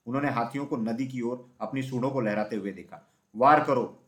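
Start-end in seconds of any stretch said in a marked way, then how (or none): 3.4-4.1 loud narrated battle cry; a brief, controlled battle shout; shouted command. A narrator's reading. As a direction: call the attack out loudly. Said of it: "porous चिल्लाया".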